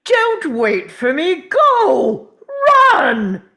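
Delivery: shouting at her to go, voice cracking high; frantic high yelling, almost a cry; urgent shout. Acted. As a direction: yell desperately for her to run. Urging Amy to flee.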